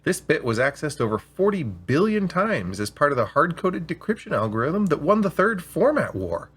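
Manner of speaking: an AI salesman boasting about its bit's history; hard sell. Acted. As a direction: build the hype like a pushy seller. AI pitching a special bit.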